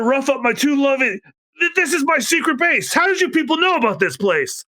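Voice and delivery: high, brash Frankie voice. Acted. Frankie suspects Zombie's men at door, brash.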